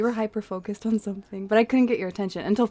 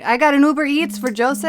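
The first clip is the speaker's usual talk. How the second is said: high, loud delivery call-out; pushy delivery courier; 6 semitones higher, 7 dB louder than usual. Pretend. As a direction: announce the order loudly to the room. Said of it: "pretending to be, uh, what, Uber Eats".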